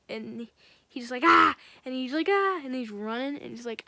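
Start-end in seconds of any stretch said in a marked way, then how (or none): 1.2-1.5 loud sudden mock scream; retold scream. Joker suddenly yells to scare the small man, loud mock scream. 2.3-2.6 high, loud mock shriek of fright; retold scream. Small man shrieks back after being startled, mock frightened shriek.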